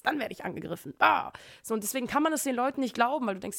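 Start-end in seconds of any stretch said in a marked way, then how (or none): 1.0-1.3 sudden sharp cry of alarm, high and loud; short startled cry. Acted. Imagining being attacked in public, a sharp alarmed cry. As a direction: yelp as if hit out of nowhere.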